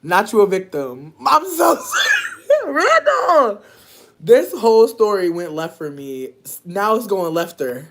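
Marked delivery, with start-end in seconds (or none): 1.3-3.6 high, squealing apology through laughter; drawn-out shriek of laughing sorry. Real, not acted. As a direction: apologize while cracking up helplessly. Apologizing while cracking up.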